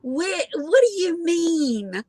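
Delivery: high, slow Southern drawl; drawn-out Southern belle question. Retold or imitated. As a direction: imitate a puzzled Southern belle, stretching the words. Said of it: "like, Southern Belle voice".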